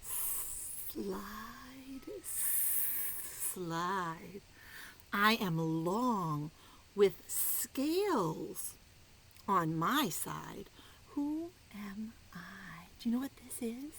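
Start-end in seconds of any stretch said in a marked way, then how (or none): 0.0-4.4 unvoiced, stretched slithering words; snake voice. Reading the snake's riddle aloud. 5.1-12.9 very slow, drawn-out words; slow riddle read. Acted. Snake riddle read slowly.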